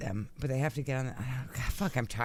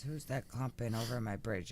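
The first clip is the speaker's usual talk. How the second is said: lightly voiced troll, lower and softer; 4 semitones lower, 5 dB quieter than usual. Troll challenges goats crossing his bridge, lightly acted low voice. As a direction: challenge them as a storybook troll, pitched down. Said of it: "the troll says".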